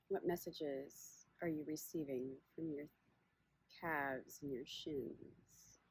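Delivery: unhurried meditation guide, long pauses between phrases; guided body scan. Acted. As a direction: guide listeners gently inward, leaving space after each question.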